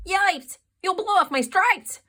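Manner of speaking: the zebra yells in alarm; loud panicked zebra; zebra yelling. A narrator's reading. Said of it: "The zebra yelled".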